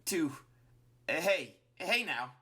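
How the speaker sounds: high, faltering stammer; nervous backpedaling. A narrator's reading. Flowey caught in a slip-up, nervous backpedaling. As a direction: stumble into a hurried correction. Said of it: "quickly tries to correct".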